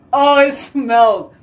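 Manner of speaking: high disgusted complaint; gross-out outburst; disgusted by smell. Real, not acted. Dog poop smell in bathroom.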